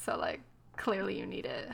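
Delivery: a sly aside under the breath; quiet mocking dig; sarcastic whisper. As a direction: whisper the jab, dry and mocking. Sarcastic jab in retold flirting.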